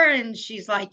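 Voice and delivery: usual speaking voice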